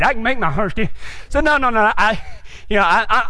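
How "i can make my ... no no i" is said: ordinary talk, nothing marked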